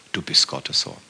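soft, low whisper "du bist Gottes Sohn"; hushed worshipful creed; whispered confession. Real, not acted. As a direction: breathe it out reverently. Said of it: "leben mit der Überzeugung".

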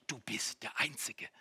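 ordinary talk

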